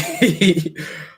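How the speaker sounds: real amused laughter; laughing. Real, not acted. Laughing at being ignored.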